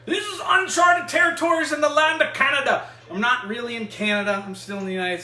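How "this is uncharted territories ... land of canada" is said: high, loud mock-epic proclamation; grand announcement. Host hailing viewers into a Canadian journey, grand shout. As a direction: proclaim it loudly, like a show opening.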